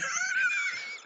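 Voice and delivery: a burst of high-pitched laughter; cracking up, high. Real, not acted. Laughing at the absurd story.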